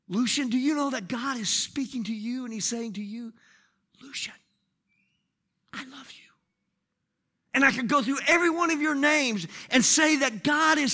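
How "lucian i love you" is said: hushed, close-up whisper; name and message in a tiny whisper. Shown as a demonstration. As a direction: breathe it privately to one person. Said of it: "So that's why I'm whispering".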